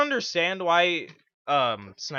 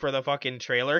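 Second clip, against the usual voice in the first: ordinary delivery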